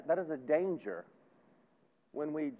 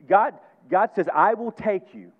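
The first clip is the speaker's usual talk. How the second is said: loud, high, slow declaration; emphatic proclamation; 7 semitones higher, 13 dB louder, slower than usual. Retold or imitated. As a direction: proclaim God's promise loudly, drawing out each word. Preacher proclaims God's promise at full force.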